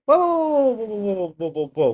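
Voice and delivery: sudden high loud whoas that settle down; startled whoas. Real, not acted. Streamer startled by the footage, loud, then calming. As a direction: jump back at the sight.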